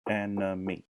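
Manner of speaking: "enemy" in three distinct beats; syllable by syllable. Shown as a demonstration. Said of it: "one word with three syllables".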